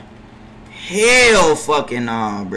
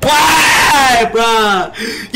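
much higher and louder, furious; angry shout; 4 semitones higher, 3 dB louder than usual. Real, not acted. Streamer raging at his center, furious shout. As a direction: yell it at the useless teammate.

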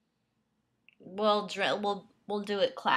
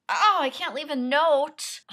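higher, louder dismay at the form; exasperated outburst; 10 semitones higher, 7 dB louder than usual. Real, not acted. Actor at her laptop, annoyed she can't add a note, exasperated. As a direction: throw up your hands at the form.

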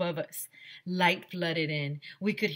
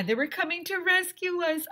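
much higher excited call; excited shout; 13 semitones higher, 3 dB louder than usual. Acted. Trapped kids hearing rescuers drilling, high excited shout. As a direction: cry it out with joy. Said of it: "I shouted".

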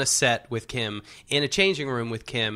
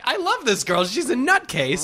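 delighted; 10 semitones higher, 4 dB louder than usual. Retold or imitated. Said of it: "I'm like".

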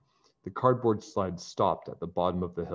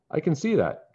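laughing; 9 semitones higher, 3 dB louder than usual. Acted. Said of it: "George laughed".

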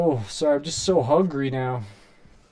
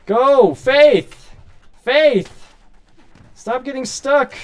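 higher and louder, exasperated calls; frustrated yelling; 8 semitones higher, 5 dB louder, much slower than usual. Real, not acted. Streamer shouting at Faith for getting stuck. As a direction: shout her name in exasperation.

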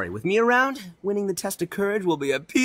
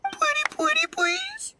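over-the-top begging. Acted. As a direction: whine it out, begging even harder. Itto begs harder.

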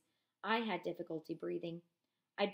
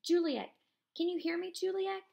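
high, raised call into the dark; 10 semitones higher, 6 dB louder than usual. Acted. Eliezer calling for Juliek in the dark, high and raised. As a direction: call a friend's name across the crush.

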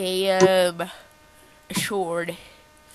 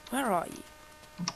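normal talking voice

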